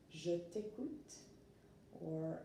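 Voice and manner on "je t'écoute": slow, careful French for learners; slow French model. Shown as a demonstration. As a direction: say each French syllable slowly and clearly. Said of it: "I would say".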